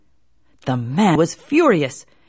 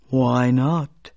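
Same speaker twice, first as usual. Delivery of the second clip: low turtle voice; 6 semitones lower than usual. Acted. Turtle voice speaks.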